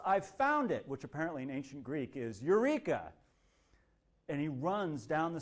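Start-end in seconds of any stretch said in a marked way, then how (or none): 0.1-0.9 raised, excited shout. Retold or imitated. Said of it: "he shouts". 2.4-3.1 raised, excited shout. Retold or imitated. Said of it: "he shouts".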